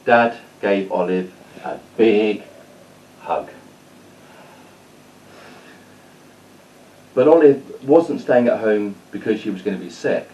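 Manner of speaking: put-on lively read-aloud storytelling for kids; story narration. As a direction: tell it like a bedtime story to children.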